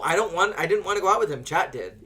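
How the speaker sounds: ordinary talk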